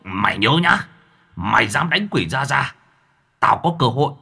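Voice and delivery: high, loud demon bluster, rising to a shriek; cowardly demon threats. Acted. Demon yelling it will rip out Mặc's heart, shrill bluster. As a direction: shout empty threats while running away.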